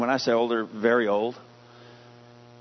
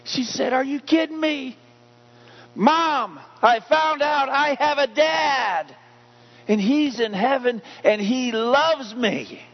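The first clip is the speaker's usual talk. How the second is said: put-on overjoyed girl; 11 semitones higher than usual. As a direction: squeal it like a girl who just found her dad. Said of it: "she jumped up and down and said".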